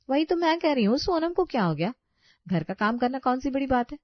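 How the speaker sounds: high, sneering echo of the question; mocking wife. Wife to husband, mocking Sonam in a high sneer. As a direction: throw his question back with scorn.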